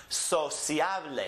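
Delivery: slow Spanish Sociable, so-SYA-bleh; next-to-last stress. Shown as a demonstration. Teacher models for students, Sociable slowly stressed in the middle. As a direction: draw out Sociable, stressing the CIA. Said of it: "it's the next to last syllable that gets emphasized".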